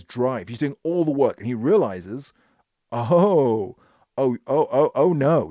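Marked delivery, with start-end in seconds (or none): 2.9-5.5 loud rising oh-no of sudden realization; a shocked, loud "oh no"; dawning alarm. Retold or imitated. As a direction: blurt it as the penny drops.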